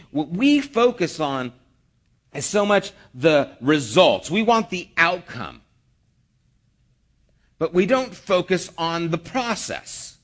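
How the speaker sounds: ordinary delivery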